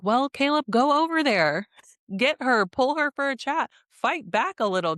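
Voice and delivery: egging Caleb on from the couch; fired-up pep talk to a hesitant guy; urging him on. Retold or imitated. Speaker urges Caleb on.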